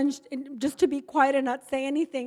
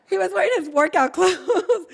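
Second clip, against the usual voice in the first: giggling through the words; amused, breaking into laughter; laughing; 7 semitones higher, 5 dB louder than usual. Real, not acted. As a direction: laugh out the end of the line. Laughing while telling story.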